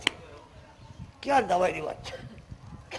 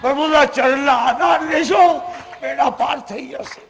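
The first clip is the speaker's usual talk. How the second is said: preaching; 6 semitones higher, 12 dB louder than usual. Real, not acted. Preacher exhorting the crowd.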